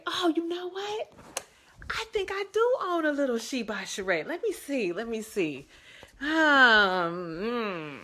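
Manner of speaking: high, sing-out pretend realization; mock pondering. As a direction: make a playful show of searching, drawing words out. Pretends to search for the item.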